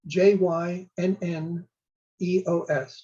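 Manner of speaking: letter by letter, slowly, in small groups; measured spelling with a short pause midway; spelled out. Shown as a demonstration. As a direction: spell it out deliberately for the listener. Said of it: "It's spelled".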